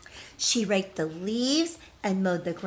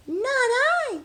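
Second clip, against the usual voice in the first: tiny mouse squeak, much higher and louder; squeaky mouse; 4 dB louder than usual. Acted. Mouse's refusal in the story.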